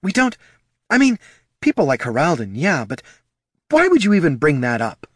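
flustered, high-pitched stop and start; halting, defensive protest; sputtering. A narrator's reading. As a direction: sputter indignantly, voice jumping up. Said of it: "The Solomancer sputtered".

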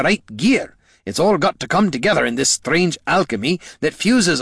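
a lightly voiced old adventurer lecturing the lad; gruff veteran mentor. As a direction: drive the point home like a seasoned old hand. Gorm explains the armory.

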